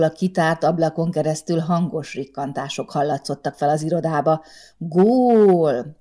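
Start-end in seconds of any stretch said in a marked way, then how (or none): 4.8-6.0 loud cry of goal from the bar; shouted goal. A narrator's reading. Fans in the café yelling a goal, loud shout. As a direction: yell it like a football crowd. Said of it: "hasított bele a levegőbe".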